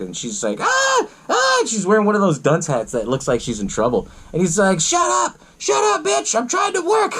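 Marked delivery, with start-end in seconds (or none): 0.6-1.6 short, very high mock screams; imitated gagged scream. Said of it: "she starts screaming, she's gagged". 4.8-7.1 high-pitched, worked-up rant; mock angry yell. Acting Otis yelling at screaming captive.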